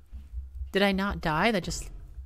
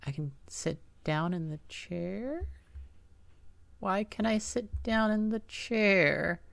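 soft puzzled; 10 dB quieter than usual. Real, not acted. Puzzled, speaking very softly.

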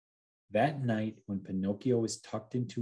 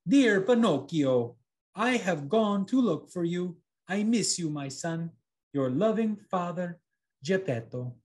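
loving father's letter; 8 semitones higher, 4 dB louder than usual. A narrator's reading. Father's letter read aloud.

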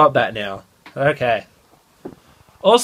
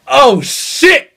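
high, loud startled yelp; playful fake alarm; mock shock; 8 semitones higher, 4 dB louder than usual. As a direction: yelp it like you just got stabbed. Imagined reaction to being stabbed.

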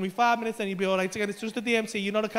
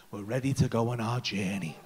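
mystical guide voice; 12 semitones lower, 3 dB quieter than usual. Retold or imitated. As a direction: intone it like a hippie guide.

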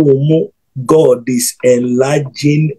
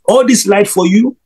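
wondering question pitched way up; amazed; 7 semitones higher than usual. Real, not acted. Preacher marvelling at light seen for a follower, astonished.

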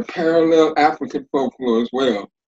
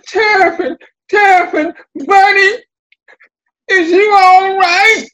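high, loud, crying-out Buzzard voice; a worried bird crying out to his buddy; Buzzard calling out; 7 dB louder than usual. Acted. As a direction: call down high and loud to a fallen friend. Buzzard calls anxiously to fallen Terrapin.

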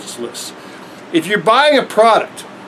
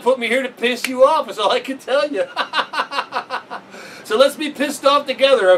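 laughing; 7 semitones higher, 4 dB quieter than usual. Real, not acted. Laughing while joking.

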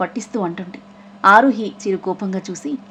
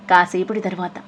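plain reading voice

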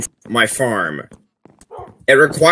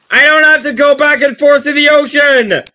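high, loud triumphant yell; excited shout; 13 semitones higher, 4 dB louder than usual. Real, not acted. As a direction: shout it out, thrilled. Excited shout about life hack.

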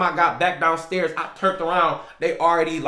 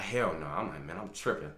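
much softer, lower, under the breath; muttered to self; 10 semitones lower, 12 dB quieter than usual. Retold or imitated. Alone outside after the scare, quiet muttering. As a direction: mutter in disbelief to yourself.

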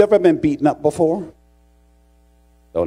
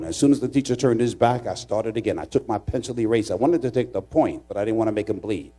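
usual speaking voice

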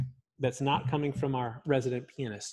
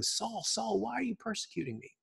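gentle voice from heaven; 7 semitones higher, 7 dB quieter than usual. Acted. Same heavenly words retold softly.

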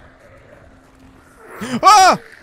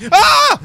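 sudden high scream; jump-scare yelp; startled scream; 10 dB louder than usual. Real, not acted. Startled cry in game. (cri de surprise aigu)

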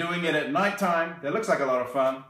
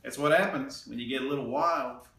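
usual speaking voice

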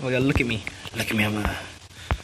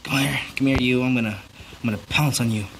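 ordinary talk, nothing marked

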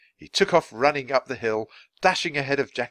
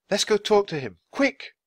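high-pitched, eager urging; urgent girl; 12 semitones higher, 4 dB louder than usual. A narrator's reading. Girl urging Jack down the hill, urgent. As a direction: hurry a companion along.

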